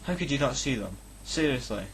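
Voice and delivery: ordinary delivery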